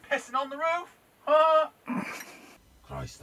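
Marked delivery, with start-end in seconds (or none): 0.1-1.7 loud high calling out; shouting. Real, not acted. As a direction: shout at someone in the dark. Calling out to an intruder.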